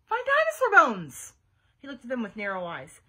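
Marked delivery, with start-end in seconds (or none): 0.0-1.3 high, slow, loud disbelief; incredulous demand. A narrator's reading. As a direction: demand it loudly, stretching it out. Doubting friend demands an answer.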